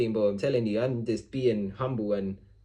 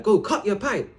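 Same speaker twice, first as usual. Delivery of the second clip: higher, louder repeated order; fed-up raised command; said loudly; 8 semitones higher, 4 dB louder than usual. Retold or imitated. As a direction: keep shouting it at him. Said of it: "I just kept telling him loudly".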